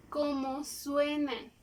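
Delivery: slowed right down for the class; slow demo. Shown as a demonstration. Teacher to pupils, saying it slowly so they follow. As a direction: stretch it out slowly. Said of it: "para que me puedan ver".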